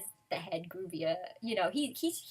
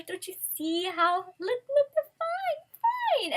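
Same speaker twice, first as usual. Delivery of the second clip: much higher, squeaky put-on voice, pleading and exasperated; mock Mashima scolding fans; 11 semitones higher, 5 dB louder than usual. The author pleads with upset fans, in a high mock whine. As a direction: play the author whining that it was only a joke. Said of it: "He's like".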